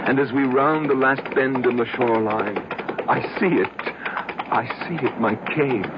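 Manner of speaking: breathless joy at the sight; rising rapture. Acted. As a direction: let the excitement lift the voice. Rapture at seeing his cave.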